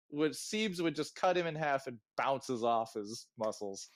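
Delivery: ordinary delivery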